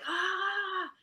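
high imitated shriek of disbelief; imitating Kelly Holmes's stunned cry; excited shriek. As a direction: yelp the way a stunned winner does. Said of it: "and she's like".

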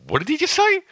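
high, stunned what did he just say; baffled reaction replayed; retold disbelief. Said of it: "I was just like".

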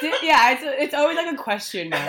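high, loud laughing talk. Real, not acted. Laughing at teasing.

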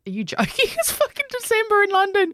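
high, laughing put-on voice of a scoffing friend; mocking his reply. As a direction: squeak out his disbelief with a laugh. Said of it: "He goes".

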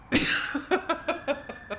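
high, airy burst of laughter; a sudden breathy laugh at his slip. A narrator's reading. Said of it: "She burst out laughing".

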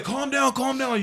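high-pitched hurried calming; imitating an anxious friend; retold urgent soothing. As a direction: talk a panicking friend down. Friend soothing his panic, retold.

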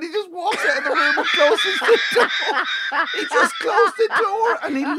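high, breaking into laughter as he tells it; can barely get the words out for laughing; giggling at the intruder who just walked out; laughing through it. Real, not acted. Laughing while telling climax.

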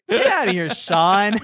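high, laughing dismissal; exasperated but amused; mock exasperation. Mock exasperation at game character.

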